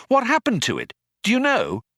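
high urgent questions; excited. A narrator's reading. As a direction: press eagerly for an answer. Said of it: "in sudden excitement".

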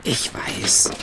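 tense whisper. Acted. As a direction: whisper so he can't hear. Hiding outside the kidnapper's house.

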